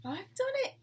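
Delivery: high, loud shout of triumph; excited yell. A narrator's reading. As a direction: yell it out, bursting with joy. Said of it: "he yelled".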